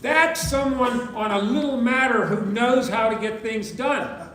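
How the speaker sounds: rally the room with conviction; full-voiced formal address; rousing speech.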